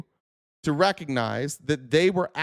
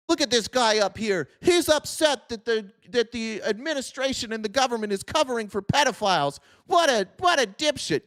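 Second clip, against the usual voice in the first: higher, sneering imitation of a troll in chat; mocking viewer voice; 5 semitones higher than usual. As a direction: jeer at yourself as the viewer would. Mocks a chat viewer's reaction.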